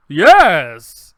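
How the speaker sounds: very loud, over-happy actor's take; hammed-up happy exclamation; happier take. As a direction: belt it out with mock cheer. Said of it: "a little happier?".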